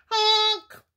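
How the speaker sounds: high, loud startled honk; a shrill alarmed goose cry. A narrator's reading. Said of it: "cried Missus Goose".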